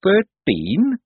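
high, wobbly guess; timid, unsteady question; quavering. A narrator's reading. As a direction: answer dazed, voice quavering upward. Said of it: "he quavered".

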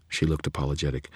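ordinary delivery, nothing marked

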